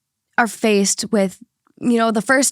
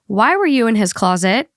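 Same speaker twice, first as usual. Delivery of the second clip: usual speaking voice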